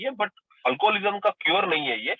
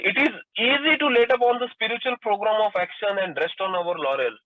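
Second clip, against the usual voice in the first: slow, deliberate reading of the quote, a little higher; slow reading aloud; 3 semitones higher, much slower than usual. Acted. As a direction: lay each word out slowly for the listeners. Reading the Big Book aloud.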